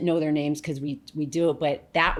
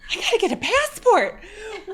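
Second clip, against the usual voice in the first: thrilled, much higher, a whoop at the end; excited; 3 dB louder, faster than usual. Retold or imitated. Telling the host about the new job, excited whoop. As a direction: burst out with joy and cheer. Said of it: "But I was so excited".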